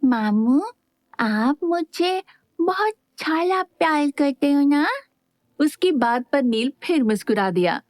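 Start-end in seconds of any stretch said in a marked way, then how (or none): 0.0-4.9 put-on higher lisping little boy voice; innocently. A narrator's reading. Little boy asking his uncle if he loves him, innocent. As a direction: ask sweetly like a small child. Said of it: "बड़ी मासूमियत से बोला".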